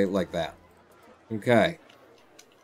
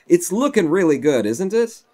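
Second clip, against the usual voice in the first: louder, higher, upbeat admiration; pleased self-praise; 6 semitones higher, 5 dB louder than usual. Real, not acted. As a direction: show off the work, inviting agreement. Admires the build, first take.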